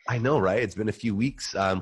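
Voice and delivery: usual speaking voice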